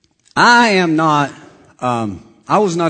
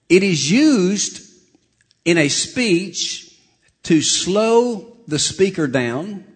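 slow, word by word; deliberately slow to make the point; slowed-down delivery; 4 semitones higher, much slower than usual. Shown as a demonstration. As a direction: draw each phrase out with pauses. Demonstrates slowing the speaker down.

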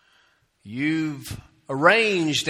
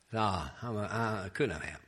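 dismissive self-talk; 4 semitones lower, 8 dB quieter than usual. Acted. Burglar reassures himself in the dark, quietly dismissive. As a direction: talk yourself out of it under your breath.

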